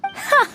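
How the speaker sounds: a short, sharp, very high "Ha!" of triumph; triumphant ha. Acted. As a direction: crow it like you finally won. Triumphant gloat after finally winning.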